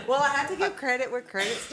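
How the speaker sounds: laughing while answering. Real, not acted. Laughing reply to teasing.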